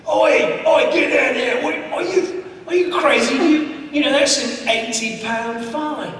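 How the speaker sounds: loud, high mock-British policeman; London bobby. Bobby scolding tourists up on a wall, loud and high-pitched. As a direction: tell them off as an outraged British bobby. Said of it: "one of the bobbies is like".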